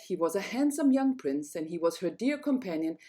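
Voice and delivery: plain reading voice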